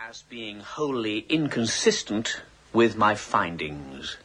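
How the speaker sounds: slow, measured dictation for a secretary; dictating. Real, not acted. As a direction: spell it out slowly so she can write it down. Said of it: "Could you read that back to me".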